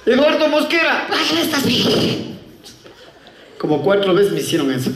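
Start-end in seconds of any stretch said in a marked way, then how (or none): 0.1-1.1 high, forceful full name; pitched-up mock bellow of a name; calling out. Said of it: "Habla como hombre". 1.1-2.0 high, squealed complaint; hammed-up pained protest; mock whiny protest. Acted officer complaining at shouting.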